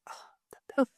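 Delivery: hushed, teasing whisper; wife's smug whispered brag. Retold or imitated. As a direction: whisper it to rub it in. Teasing husband, walking away.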